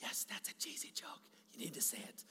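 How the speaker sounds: sly urging whisper; the inner dad-joke voice whispering. Pretend. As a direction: coax him like a voice on the shoulder. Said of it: "there's this voice like".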